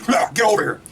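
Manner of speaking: high, harsh Scorpion battle cry; Scorpion yell. Retold or imitated. Scorpion pulling Cole away from Sub-Zero, yelling high. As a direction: bark it like the ninja hooking his foe. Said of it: "Scorpion goes".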